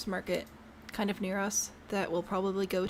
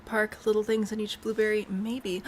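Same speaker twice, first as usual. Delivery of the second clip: ordinary talk, nothing marked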